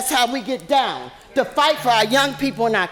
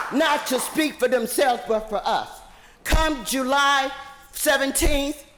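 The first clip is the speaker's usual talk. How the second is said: ordinary delivery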